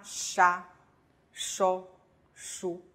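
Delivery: 沙, 收, 舒 as Italian sha, sho, shu; 沙, 收, 舒 with pure vowels after sh; sh syllables. Shown as a demonstration. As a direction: model 沙, 收, 舒 one by one. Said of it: "把它整体shi拼上啊".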